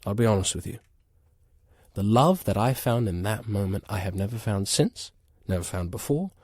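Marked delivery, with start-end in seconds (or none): none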